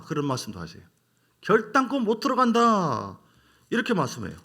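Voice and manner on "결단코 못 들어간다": high, loud, drawn-out angry decree; 하나님의 진노. Acted. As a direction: pronounce God's furious verdict, slowly and forcefully. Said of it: "하나님 막 열을 받으세요". (노한 목소리로 길게 외치는 선고)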